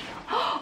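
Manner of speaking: quick shocked gasp; a little sharp intake of breath, caught out. Real, not acted. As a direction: suck in air in playful mock shock. Owns up to trying clothes on in store.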